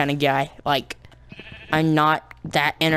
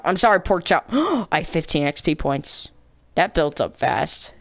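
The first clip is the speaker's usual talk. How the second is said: normal talking voice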